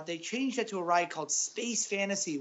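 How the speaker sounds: ordinary talk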